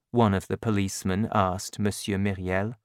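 usual speaking voice

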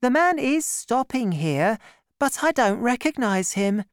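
put-on high, gentle girlish voice; young woman. A narrator's reading. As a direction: play Constance, shy and kind. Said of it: "Madame Bonacieux was saying".

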